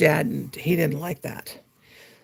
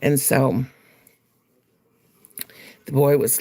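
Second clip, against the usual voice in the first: ordinary talk, nothing marked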